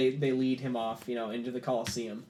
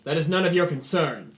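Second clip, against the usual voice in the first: louder, dismissive rebuke; curt dismissal; 4 semitones higher, 8 dB louder than usual. Acted. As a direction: shut them down firmly. Kor silences the crowd.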